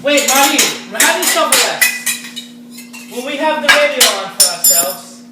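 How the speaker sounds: high, loud call over the radio; calling loudly across the room. Acted. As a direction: raise the voice to be heard over the music.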